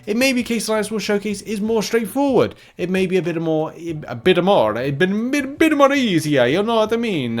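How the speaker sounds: sing-song mock Italian, high and bouncy; playful put-on Italian accent. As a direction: slip into a jokey Italian lilt. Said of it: "makes you do an Italian accent for no reason".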